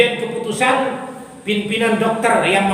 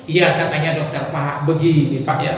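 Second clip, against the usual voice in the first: low, measured doctor voice; calm doctor; 7 semitones lower than usual. Acted. Doctor settling a nervous patient, calm. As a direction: drop low and reassure the patient.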